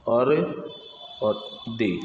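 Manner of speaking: normal talking voice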